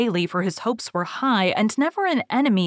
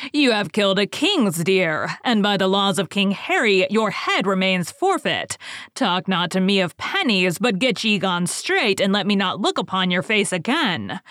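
plain reading voice

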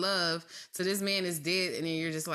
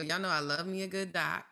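normal talking voice

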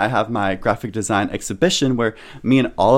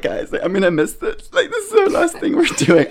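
mock teary plea, laughing through it; half-laughing, half-crying, pitched up high; sappy crying; 12 semitones higher than usual. Student telling classmates she'll miss this, mock teary. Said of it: "I'm the sappy bitch that, like, cries".

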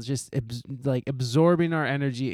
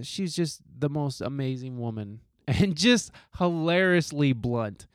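ordinary talk

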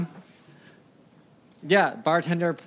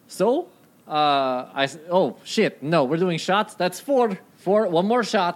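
Anamik, excited; 5 semitones higher, 6 dB louder than usual. Acted. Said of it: "and she says".

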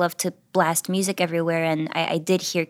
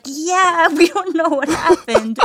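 laughing quote; 10 semitones higher, 4 dB louder than usual. Retold or imitated. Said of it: "they're like".

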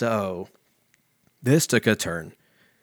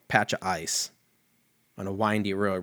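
ordinary talk, nothing marked

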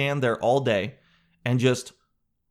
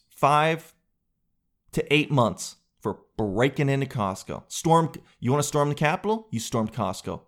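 ordinary delivery

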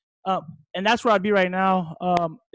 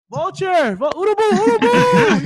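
high, loud shouts building higher and louder; imitated excited shouting; 13 semitones higher, 8 dB louder, slower than usual. Onlookers reacting to his knee in the air, shouted cheer. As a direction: mimic a crowd hollering a nickname.

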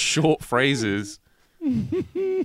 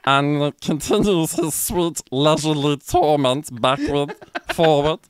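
Jar Jar Binks; 3 semitones higher than usual. Acted. Murmuring romance-novel lines to a lover, goofy Jar Jar impression. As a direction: read it as a silly alien, fighting giggles. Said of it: "to read in Jar Jar Binks' voice".

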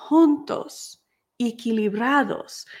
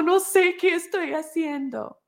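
higher, pleading voice of a worn-out mother; despairing mother; 8 semitones higher than usual. Retold or imitated. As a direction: cry out that she can't do this, high and strained. New mother's despairing thoughts. (madre desesperada, voz aguda y suplicante)

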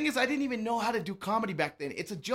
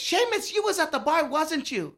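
much higher, sharper scolding wife voice; the wife catching him out, pitched way up; 8 semitones higher than usual. Acted. Joke's wife at the door scolds her drunk husband in a high voice.

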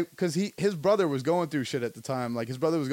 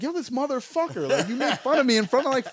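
indignant outburst; 10 semitones higher, 4 dB louder than usual. Retold or imitated. Indignant rant at comedian on podcast.

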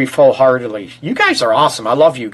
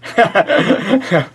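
chuckling after a tease; laughing; 3 semitones higher than usual. Real, not acted. Host teasing an old friend, laughing. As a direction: laugh at your own wisecrack.

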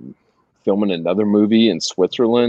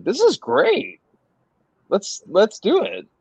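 high-pitched eager yes; acted excitement at normal volume; retold enthusiasm; 14 semitones higher, slower than usual. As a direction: re-enact being sold on it, pitch leaping up. Retelling his keen reply to script.

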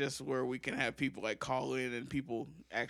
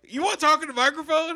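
sharp, high snappy jab; 14 semitones higher, 12 dB louder than usual. Retold or imitated. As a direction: snap it out high and loud. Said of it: "I was like".

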